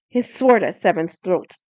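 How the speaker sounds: usual speaking voice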